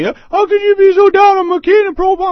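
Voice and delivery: high, loud mock complaint; mock emailer. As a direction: squeal it like an indignant letter writer. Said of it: "all these guys email me".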